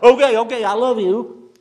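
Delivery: strained husband in a headlock, loud and high; a husband forced to say it, loud and strained; forced mumbled. Retold or imitated. Preacher voices a husband in a headlock, squeezed out loud. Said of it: "he finally mumbles it out".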